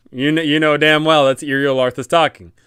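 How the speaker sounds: usual speaking voice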